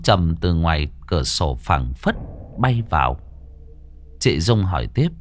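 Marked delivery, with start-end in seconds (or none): none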